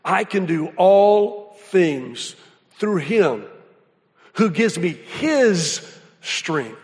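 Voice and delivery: slow, measured reading of the verse; slow recitation. Shown as a demonstration. Pastor reciting his favourite verse to the congregation, slow and measured. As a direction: lay each phrase down slowly with pauses. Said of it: "that says this".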